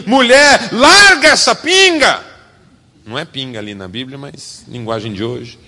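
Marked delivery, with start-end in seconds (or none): 0.1-2.2 loud, high scolding rebuke; mock scolding priest; harsh priest scolding. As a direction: bark it at her like a rude priest. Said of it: "Ele olha pra ela e diz assim".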